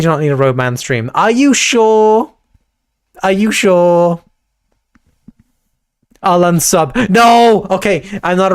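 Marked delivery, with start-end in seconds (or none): 1.1-2.3 slow, higher, louder teasing ask; mock roadman voice. Teasing audience. 7.1-7.7 loud high no; shouted no. Real, not acted. Threat to unsub.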